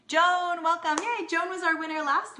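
high, loud, delighted welcome; excited greeting. Real, not acted. Host greeting last week's winner Joan in the comments, excited. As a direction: cheer her name as she pops in.

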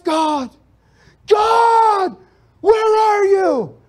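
high, loud anguished cries; desperate yelling at God. Retold or imitated. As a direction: cry out to an absent God, voice breaking. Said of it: "I was yelling at the ceiling".

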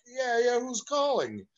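put-on high, eager boy on the phone; kid answering phone. Boy answers the house phone, high and eager. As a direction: pitch it up like a young kid picking up. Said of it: "And I'm like".

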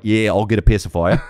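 mock Australian toddler; Australian accent. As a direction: put on a broad Aussie accent. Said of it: "no tattoos in Australia".

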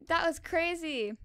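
ordinary delivery

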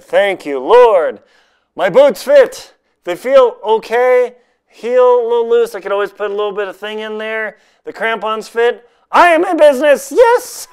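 high, loud, joyful; elated. Real, not acted. Vlogger thanking the Lord the boots fit, elated and loud. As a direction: gush with relief and joy.

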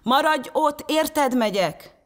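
high, urgent narrated shout; shrieked to brother. A narrator's reading. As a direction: call across to a brother in danger. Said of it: "rikoltotta öccsének".

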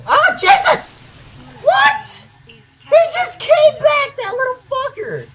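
very high, shocked yelp; startled outburst. Real, not acted. Gamer as enemy just came back, startled high outburst. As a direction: yelp in high-pitched disbelief, dragging it out.

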